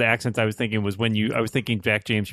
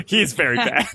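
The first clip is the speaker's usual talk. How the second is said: higher voice breaking into laughter; can't help laughing at it; amused laughing; 8 semitones higher than usual. Real, not acted. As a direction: say it through a real laugh. Laughing at a bad accent.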